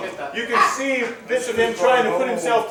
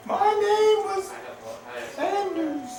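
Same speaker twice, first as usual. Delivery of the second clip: high, slow, wispy spirit voice; a small lost ghost; little ghost voice; 10 semitones higher, much slower than usual. Acted. GM voices dead elf spirit.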